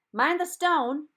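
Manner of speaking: high, loud warning call; the Reb boy warns Charlie before throwing the rock, calling loudly. A narrator's reading. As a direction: shout it across before you throw. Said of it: "the boy called".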